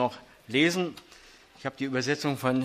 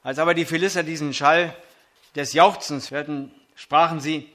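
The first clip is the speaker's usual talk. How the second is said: scripture reading; 3 semitones higher than usual. Acted. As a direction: proclaim the text to the congregation. Reads 1 Samuel 4 aloud.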